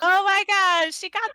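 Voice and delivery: high, loud delighted squeal; excited squeal. Real, not acted. Delighted gasp at the gift.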